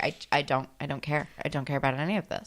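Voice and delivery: low, soft, fast apathetic mumble; bored mumble. Retold or imitated. Bored student imitated.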